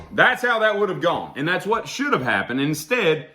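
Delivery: normal talking voice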